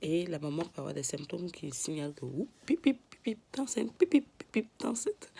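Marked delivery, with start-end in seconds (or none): 2.3-5.3 high beeping pip pip alarm. Pretend. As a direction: squeak like a pregnancy alarm. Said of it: "Ne faites pas attention avec conneries".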